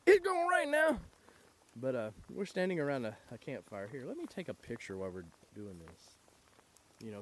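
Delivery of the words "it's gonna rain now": high mock imitation. Mimics JR's forecast.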